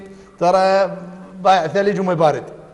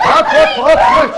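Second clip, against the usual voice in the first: excited calls; 8 semitones higher, 6 dB louder than usual. Real, not acted. Calling out during scuffle.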